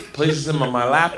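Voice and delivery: ordinary talk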